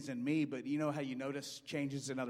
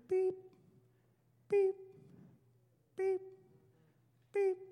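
imitated machine beep; slower than usual. Imitates life support machine.

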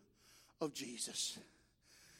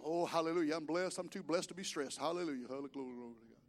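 mock pious churchgoer; 7 semitones lower, 7 dB quieter, faster than usual. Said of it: "You know the people I'm talking about".

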